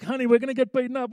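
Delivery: high, quick mock panic; hurried high-pitched fretting; freaking-out husband. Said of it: "he's freaking out".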